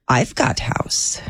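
mock Eastern European accent, slow; lightly hammed Eastern European accent. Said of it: "Is this Eastern European?".

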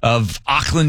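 ordinary talk, nothing marked

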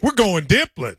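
"dippling" said with excitement; different feel. Shown as a demonstration. Showing friend how to say dippling, excited. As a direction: sell "We're going dippling" with excitement. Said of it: "say it with a different feel".

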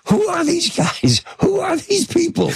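very high-pitched, incredulous outburst; Jack Nicholson, freaked out. Retold or imitated. Actor unnerved by his in-character castmates, imitated high-pitched alarm. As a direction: imitate a bewildered actor losing it. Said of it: "it's Jack".

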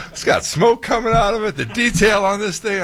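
high-pitched gushing, acting out awe; excited squeal of a retold reaction; retold amazement. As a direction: gush over it way up high. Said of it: "And I'm like".

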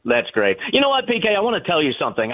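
ordinary delivery

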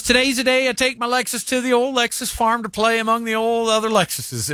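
put-on high, sing-song old lady; sustained high pitch, sweetly announcing; Martha. Old lady on Instagram giving up her Lexus, high elderly voice. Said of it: "She goes".

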